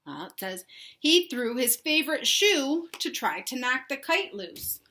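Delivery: usual speaking voice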